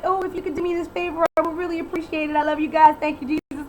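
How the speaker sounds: high, fast, syrupy fake-nice voice; sugar-coat it like you're faking sincerity; mock sweetness. Mocking Kelly's sweet talk.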